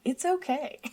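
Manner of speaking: high, gentle imitation of comforting; nice, reassuring. Imitating her co-host calming her down, nice and reassuring. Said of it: "you say it in a nice way".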